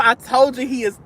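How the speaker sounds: ordinary delivery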